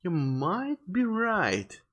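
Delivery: slow concession leaning hard on "might"; stress on MIGHT. Acted. Officer conceding to the parents, heavy stress on "might". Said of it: "Emphasis on the might".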